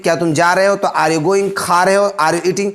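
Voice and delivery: ordinary delivery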